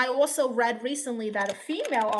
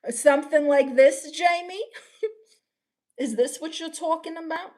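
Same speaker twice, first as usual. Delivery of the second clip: put-on British accent; 5 semitones higher, 4 dB louder than usual. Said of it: "make the British character".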